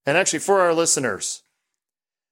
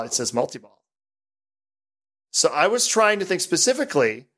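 normal talking voice

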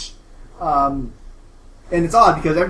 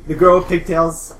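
usual speaking voice

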